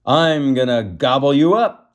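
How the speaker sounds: hammed-up troll menace; troll threat. A narrator's reading. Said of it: "the troll growled".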